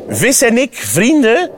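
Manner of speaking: high, scoffing frog question; incredulous exclamation. A narrator's reading. Frog scoffing at friendship with fish, high and incredulous. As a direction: exclaim it as if absurd. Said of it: "roept de kikker".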